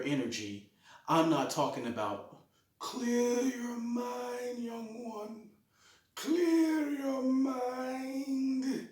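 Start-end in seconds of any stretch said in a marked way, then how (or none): 2.8-8.9 slow, high, drawn-out sage voice; calm wise martial arts master. Retold or imitated. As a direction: intone it like an old kung fu master. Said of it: "it's not about that kind of clear your mind".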